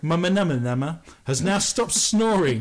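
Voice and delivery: manama said slow and deliberate; slowly correcting to manama; careful slow fix of a slip; corrected mispronunciation. Real, not acted. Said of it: "Your manother".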